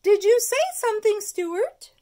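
high, loud schoolteacher voice; teacher voice. Acted. Miss Finnegan quizzing the geography class, high and loud. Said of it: "asked Miss Finnegan".

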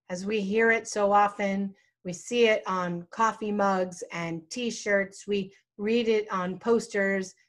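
ordinary delivery

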